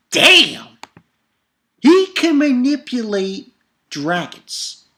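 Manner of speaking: loud, high burst of amazement; hammed-up stunned fan; excited awe. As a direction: blurt it out, thrilled. Reacting in awe to chapter.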